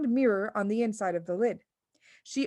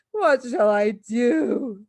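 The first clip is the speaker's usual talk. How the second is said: slow, louder wail; 2 semitones higher, 7 dB louder, much slower than usual. A narrator's reading. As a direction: wail in despair. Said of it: "wailed the father".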